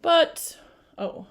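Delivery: plain reading voice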